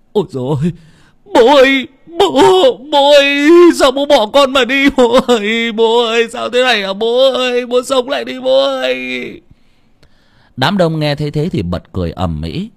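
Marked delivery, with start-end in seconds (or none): 0.1-9.4 high, loud grief-stricken howl; wailing, voice cracking. A narrator's reading. Son wailing over his father on the riverbank, howling till his voice breaks. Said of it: "gào lạc cả giọng".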